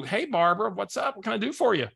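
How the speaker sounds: cheery greeting. Retold or imitated. Cheerful phone greeting retold.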